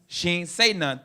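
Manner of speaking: normal talking voice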